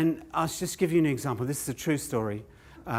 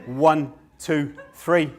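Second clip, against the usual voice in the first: ordinary talk, nothing marked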